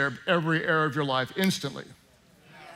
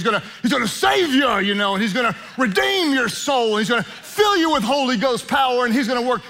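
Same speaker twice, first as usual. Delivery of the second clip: raised, loud country preacher delivery; 6 semitones higher, 3 dB louder than usual. Acted. Pastor mimicking preachers who say Jesus fixes everything, country preacher voice. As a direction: put on a swooping country preacher voice. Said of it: "my country preacher voice".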